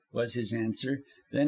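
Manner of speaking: plain reading voice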